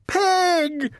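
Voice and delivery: very high shouted accusation. A narrator's reading. Eugenia shouts at Mercy through the window, high shout. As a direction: shriek the one word. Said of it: "shouted Eugenia".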